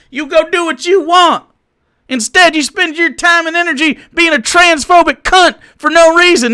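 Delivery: high, loud tirade in a put-on accent; heated rant. Real, not acted. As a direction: shout down the viewer in indignation. Mock-accent tirade at a chat viewer.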